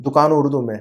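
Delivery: usual speaking voice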